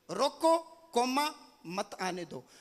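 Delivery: रोको set apart, spoken "coma", then falling मत आने दो; pause after रोको. Shown as a demonstration. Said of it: "केवल कोमा आगे पीछे करने से सेंस बदल गई".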